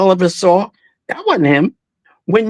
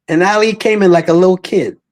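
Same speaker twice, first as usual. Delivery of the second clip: usual speaking voice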